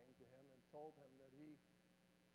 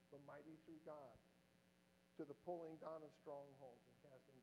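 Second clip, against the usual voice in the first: scripture reading; 3 semitones lower than usual. Acted. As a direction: read it low, almost to yourself.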